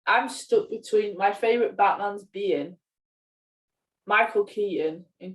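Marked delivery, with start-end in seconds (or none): none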